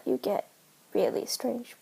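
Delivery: ordinary talk, nothing marked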